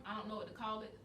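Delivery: normal talking voice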